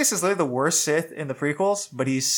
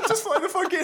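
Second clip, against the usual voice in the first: laughing hard. Real, not acted. Laughing fit about Batman.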